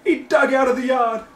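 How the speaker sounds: loud, high sobbing plea; panicked wailing. Acted. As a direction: keep wailing through the whole story. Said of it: "started wailing".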